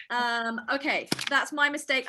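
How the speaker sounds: normal talking voice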